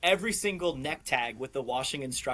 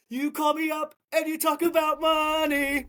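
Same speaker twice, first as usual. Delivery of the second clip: much higher, louder mocking whine; 13 semitones higher, 5 dB louder than usual. Telling coworkers about Ian's call, in a much higher mocking whine. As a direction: ham up a sneering imitation of the caller.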